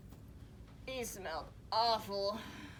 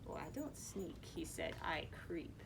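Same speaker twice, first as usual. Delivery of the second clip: softer, lower sly voice; creepy lizard; 4 semitones lower, 6 dB quieter than usual. Acted. Creepy lizard introduces himself.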